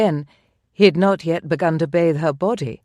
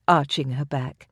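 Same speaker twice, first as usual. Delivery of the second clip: ordinary delivery, nothing marked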